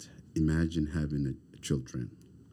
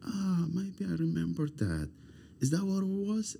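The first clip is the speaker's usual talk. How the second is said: much higher, voicing his son's vague question. Father imitating his grown son, high and wondering. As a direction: lift way up to play the son asking if he remembers. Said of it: "And maybe sometimes he say".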